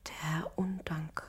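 slow, scared whisper. Acted. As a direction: hush it in dread. Frightened whispers.

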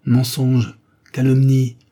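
loud, high indignant protest. A narrator's reading. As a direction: fling back the denial loudly. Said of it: "protesta la duchesse". (protestation indignée et forte)

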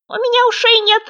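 very high, loud squeak; thin, high frog voice; thin little shout. A narrator's reading. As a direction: pipe it loudly as a tiny creature. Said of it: "тоненько кричал кто-то".

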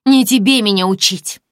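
loud, high snap; angry. Acted. Snow Maiden silences Aglaya, angry. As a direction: bite off the command.

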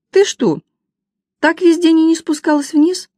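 high, loud, incredulous questioning; indignant scolding. A narrator's reading. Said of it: "возмутилась я".

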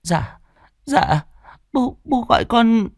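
put-on high, timid stammer of a scared boy; small boy called by his cruel stepmother, high and fearful; frightened child. A narrator's reading. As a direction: answer hesitantly, afraid of a beating. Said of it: "Thằng cu cò sợ sệt".

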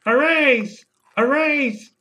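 high-pitched parrot call; squawking parrot. Acted. Parrot interrupts a performance review from the bag, squawking parrot. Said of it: "and the parrot".

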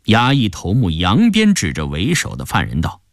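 ordinary delivery, nothing marked

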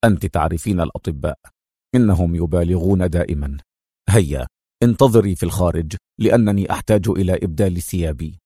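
low, firm man's voice; stern reply. A narrator's reading. Man pulls out his tubes, low and stern. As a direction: refuse curtly. Adham dismisses her concern.

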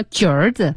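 "橘儿子" with the ü dropped straight into er; modified pronunciation. Shown as a demonstration. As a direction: say "橘儿子" pouting, skipping the ü sound. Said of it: "但是不说吁的音".

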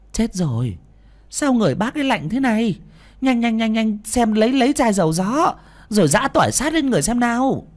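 high, loud urgent orders; urgent alarm. A narrator's reading. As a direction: rush everyone. Woman urges quick rescue.

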